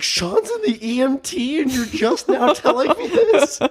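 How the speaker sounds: high-pitched incredulous outburst; outraged disbelief, half laughing; losing his mind. Retold or imitated. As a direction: retell a freak-out, voice shooting way up. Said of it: "lost my mind for a minute".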